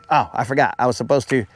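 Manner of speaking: ordinary talk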